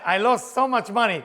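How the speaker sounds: loud, high mock wail; acted lament. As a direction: cry it out, hamming up the loss. Acts out drinker lamenting losses.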